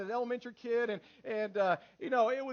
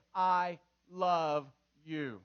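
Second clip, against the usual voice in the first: slow, lower, word-by-word delivery; deliberate; 5 semitones lower, much slower than usual. Shown as a demonstration. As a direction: space out each word for emphasis. Models what children should say.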